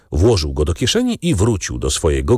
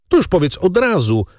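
high-pitched exclamation with a note of bitterness; bitter outcry; 10 semitones higher than usual. A narrator's reading. Captive Danglars objects to the price of wine, bitter outcry. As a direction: cry it out bitterly, without shouting. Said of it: "krzyknął Danglars z nutą goryczy".

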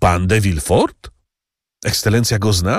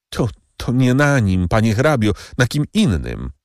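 higher, pleading servant voice; slowing on the last words; anxious servant Bertuccio; 7 semitones higher than usual. A narrator's reading. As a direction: insist on your honesty to the count. Bertuccio stammers.

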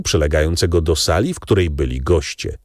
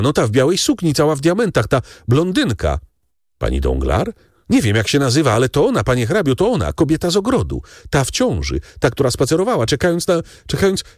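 higher and higher, tumbling out; agitated alarm; 8 semitones higher, faster than usual. A narrator's reading. Terrified servant to the count, rising panic. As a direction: panic building until the words fail. Bertuccio agitated, recognising woman.

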